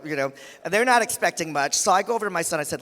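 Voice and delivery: ordinary delivery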